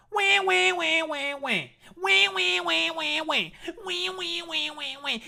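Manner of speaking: high, loud whiny fake wailing; mock baby crying. Streamer mocks a chatter as a snowflake, fake baby wails. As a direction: wail like a bratty crybaby.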